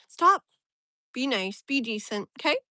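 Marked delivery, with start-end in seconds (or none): 0.1-0.4 loud, high, sudden stop; sharp command. Real, not acted. Streamer telling someone to stop, forcefully. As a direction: order them firmly to quit.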